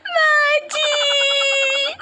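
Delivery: high, loud drawn-out wail; crying out. Acted. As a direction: cry out her name in tears, very slow. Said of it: "तू पहले चुप हो जा". (रोकर ज़ोर से पुकारना)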